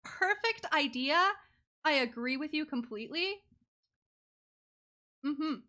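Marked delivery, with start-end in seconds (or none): none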